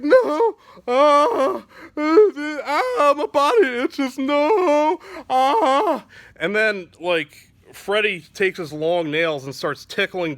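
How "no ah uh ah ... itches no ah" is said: high, loud whimpering moans; anguished cries. Acted. Danny Glover tormented by itching, crying out high and loud. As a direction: squeal in itchy agony. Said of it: "Danny's like".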